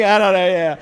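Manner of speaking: usual speaking voice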